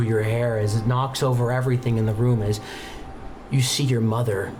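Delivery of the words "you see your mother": ordinary talk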